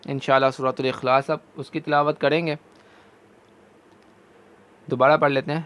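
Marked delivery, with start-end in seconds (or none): none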